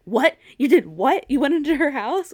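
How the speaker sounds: loud, shocked questions; Emily yelling in disbelief at Andy. Retold or imitated. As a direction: hit each question with alarm. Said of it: "She's like".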